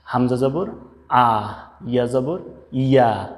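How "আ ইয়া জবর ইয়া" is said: "ইয়া" said firmly and slowly; slow firm letter drill; firm Arabic drill. Shown as a demonstration. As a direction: press "ইয়া" hard, teacher style. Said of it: "শক্ত করে".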